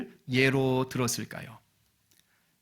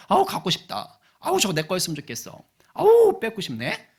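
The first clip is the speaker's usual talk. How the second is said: higher-pitched covetous longing; acted childish envy; 5 semitones higher, 5 dB louder than usual. Young boy eyeing friend's toy, acted childish envy. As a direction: pine for it, rising to a high, loud groan.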